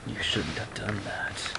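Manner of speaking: creepy ghost voice. Acted. Haunted game spirit warning the player, slow and hushed. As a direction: breathe it out slowly like a ghost. Ben's menacing line in the story.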